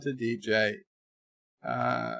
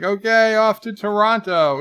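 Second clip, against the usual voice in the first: loud, high mock eagerness; hammed-up rallying cry; gung-ho film character; 7 semitones higher, 8 dB louder than usual. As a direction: announce it like charging off on a mission. Acts film character setting off eagerly.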